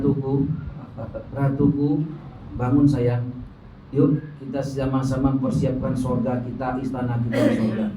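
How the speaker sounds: low, soft pillow voice; acted tender murmur; imitated husband's whisper. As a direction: coax a sleeping wife awake, hushed. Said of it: "dia bisikin di telinga saya".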